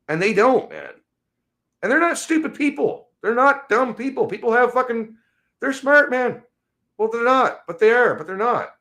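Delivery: high-pitched, exasperated rant; real frustration. Real, not acted. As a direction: vent in helpless disbelief, pitch way up. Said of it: "it's frustrating".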